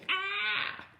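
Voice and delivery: short, very high acted scream. Brother and sister at thunderclap, high acted scream. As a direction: shriek in fright without much volume. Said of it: "We both screamed".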